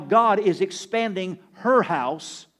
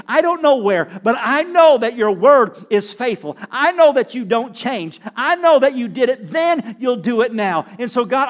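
louder, higher impassioned declaration of trust; fervent prayer; 5 semitones higher, 9 dB louder than usual. Acted. Widow praying before baking the cake, loud and fervent. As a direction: cry out your faith to God.

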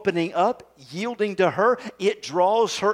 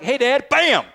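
playful shout; 5 semitones higher, 5 dB louder than usual. Retold or imitated. Retold greeting punch to dad.

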